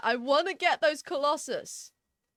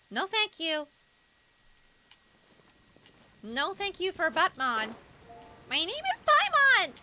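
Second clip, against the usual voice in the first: Paimon voice; slower than usual. Pretend. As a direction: chirp it out in character. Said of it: "I'm getting really into character now".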